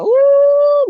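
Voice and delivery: sudden high loud ooh; surprised high squeal; startled ooh. Real, not acted. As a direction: yelp of surprise at the card. Startled by spirit reading.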